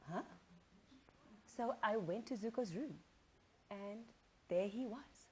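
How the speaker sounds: usual speaking voice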